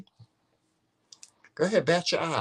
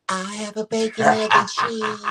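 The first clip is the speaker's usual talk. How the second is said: high, put-on ordering voice; mocking his guy-talk voice; 8 semitones higher than usual. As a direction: tease him with a high-pitched imitation. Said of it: "when he talking to guys, it's a totally different story".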